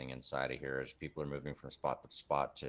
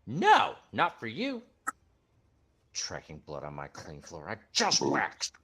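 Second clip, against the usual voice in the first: louder, higher snappy scold; fussy gnome scolding; 4 semitones higher, 7 dB louder than usual. Acted. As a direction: scold like a house-proud cleaner.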